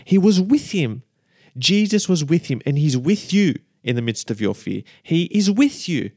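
ordinary talk

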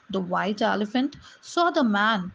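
ordinary delivery, nothing marked

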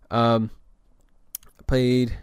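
usual speaking voice

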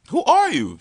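high, loud disbelief; who are you, stunned and loud; man stunned by the woman who catfished him; shocked. Retold or imitated. Shocked at seeing his date.

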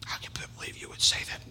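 mock-scandalized whisper. Pastor feigning shock at Paul, whispered. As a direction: breathe it as a shocked aside.

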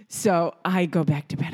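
ordinary delivery

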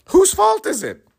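rising outburst. Real, not acted. Repeating it to the audience in a high exasperated shout. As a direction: throw the question out as an exasperated near-shout.

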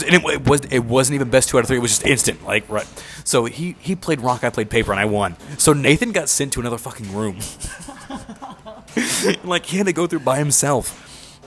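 ordinary talk, nothing marked

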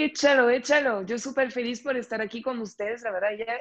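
ordinary talk, nothing marked

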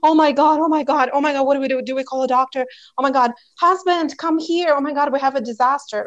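panicking parent. Acted. Parent calling the husband over a child's small hurt, mock panic. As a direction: act out an overdramatic parent in a panic. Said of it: "not cry with their child about it and be like".

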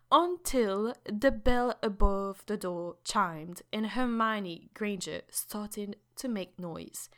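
slow British documentary narration; measured British audiobook reader; British accent. Shown as a demonstration. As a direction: read it like a British nature documentary, unhurried. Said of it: "vas-y avec ton accent british".

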